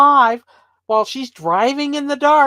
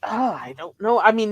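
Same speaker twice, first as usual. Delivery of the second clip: ordinary delivery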